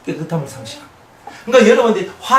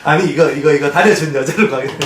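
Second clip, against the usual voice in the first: joking while laughing; 4 semitones lower than usual. Retold or imitated. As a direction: tease through laughter. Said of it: "그냥 웃으면서".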